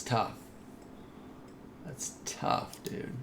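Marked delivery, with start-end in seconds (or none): 1.8-3.1 breathy whisper, barely voiced. Real, not acted. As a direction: mutter it under your breath. Repeats the phrase in whisper.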